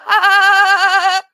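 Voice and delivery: high bleating laugh; bleating cackle; goat-like laugh. Real, not acted. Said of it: "Do I sound like a goat?".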